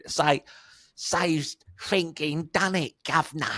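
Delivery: imitating a thick Colchester accent, quick quoted line. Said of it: "in that, his thick Colchester accent".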